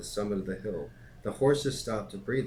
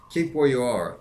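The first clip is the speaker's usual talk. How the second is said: loud, higher guard's command; called order; 4 semitones higher, 8 dB louder than usual. A narrator's reading. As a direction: call out to halt a stranger. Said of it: "the guard called to the voice".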